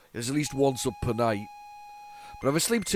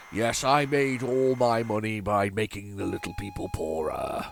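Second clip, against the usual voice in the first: smug rich man on a yacht; 3 semitones lower than usual. Retold or imitated.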